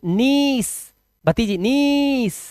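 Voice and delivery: नीस with ee stretched; long ee. Shown as a demonstration. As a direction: hold the vowel in नीस, "neees". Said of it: "ई लंबा लेना".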